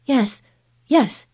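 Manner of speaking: ordinary delivery, nothing marked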